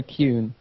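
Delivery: Kuhn said as "kyoon"; one syllable, Kuhn rhyming with "tune", with a y glide; name pronunciation. Shown as a demonstration. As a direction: say Kuhn like "cue" with an n on the end. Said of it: "final pronunciation on your name".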